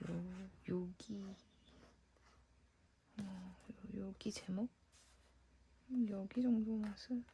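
whispered to herself while working. Real, not acted. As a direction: breathe the words out, no voice. Murmuring to herself while decorating.

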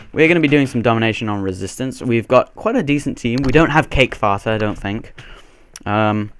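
ordinary talk, nothing marked

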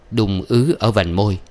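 ordinary delivery

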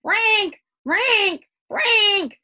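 loud, high repeated bird squawks; sudden harsh squawks, pitched right up; frantic heron croaks. A narrator's reading. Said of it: "it was repeated hurriedly, frantically it seemed".

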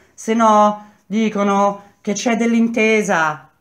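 high, loud calling-out voice; woman shouting. A narrator's reading. Woman in the street to arrested men, shouting. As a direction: shout it up to prisoners behind bars. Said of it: "una donna gridò".

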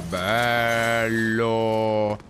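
deep, stretched bello; low drawn-out voice. Pretend. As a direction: drag it out low. Repeated exclamation, varied voices. (voce bassa e strascicata)

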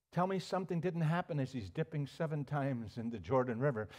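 usual speaking voice

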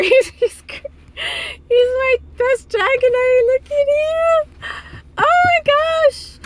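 high, laughing gush over the Pokemon; giddy excitement. Real, not acted. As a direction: gush with delight. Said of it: "I'm just so excited".

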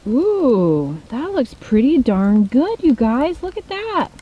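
loud, impressed cooing that climbs high; delighted showing-off. Real, not acted. Camper showing viewers the chili mac, delighted showing-off. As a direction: show it off with loud, swooping delight.

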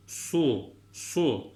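"su" with a heavy s and forward-rounded lips; thick "su". Shown as a demonstration. Teacher models the Arabic letter sad, thick rounded "su". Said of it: "Kalın su. Dudağımız ileride".

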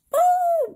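high loud explosive boom. Pretend. The whale blowing its top, a sudden high boom. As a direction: burst it out like a spout blowing.

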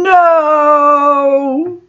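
high drawn-out cry of no; hammed-up anguished no; bellowing. A narrator's reading. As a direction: bellow it in grief. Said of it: "everyone bellowing".

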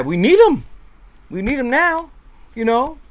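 high, loud insistence; urgent plea. Real, not acted. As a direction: beg for him like it can't wait. Pleading for the player.

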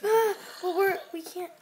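high, rising panic; flustered cry as the zombie closes in; panicked. Real, not acted.

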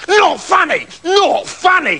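high, outraged yell; storybook king's tantrum scream. A narrator's reading. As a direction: shriek it high, as an affronted king. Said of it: "screamed the king".

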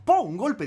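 a loud, high "¡pum!" for the impact; loud crash noise. Retold or imitated. Retelling the car crash to his friend with a loud "¡pum!". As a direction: burst out with the bang of the crash. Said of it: "retelling a crash".

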